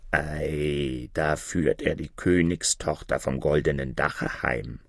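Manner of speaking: a raven muttering from the sky; croaking raven. Acted. Raven telling the others the princess is being taken home, low and soft.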